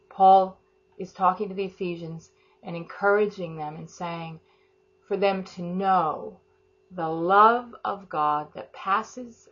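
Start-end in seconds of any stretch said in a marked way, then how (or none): 5.1-9.5 drawn-out scripture phrase; slow right down and lean on each word; stretching out the verse for the congregation; slow emphasis. Real, not acted. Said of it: "slow emphatic scripture".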